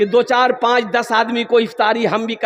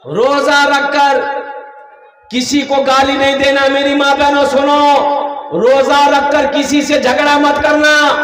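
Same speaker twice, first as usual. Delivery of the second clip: raised, chant-like sermon delivery with stretched line endings; forceful preaching; 2 semitones higher, 3 dB louder than usual. Real, not acted. Preacher warning mothers and sisters about fasting, forceful preaching. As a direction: preach it out to the whole crowd.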